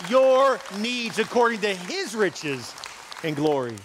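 high, loud, slow preaching swell; preaching at full force. Acted. Pastor proclaiming the King of Kings to the church, in a slow, soaring preach. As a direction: stretch each phrase out and build. Preaching climax on God's riches.

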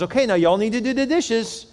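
sweet grandma giving orders. Retold or imitated. Grandma telling kids dishes.

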